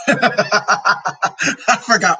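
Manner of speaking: real laugh breaking into the words. Real, not acted. Laughing at forgetting what PMS means, amused. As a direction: laugh at your own slip as you speak.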